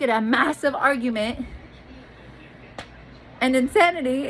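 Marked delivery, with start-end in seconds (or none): none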